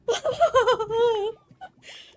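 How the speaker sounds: loud, high burst of uneasy laughter; nervous laugh. Real, not acted. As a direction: laugh loudly out of sheer dismay. Nervous laugh after horrifying reveal.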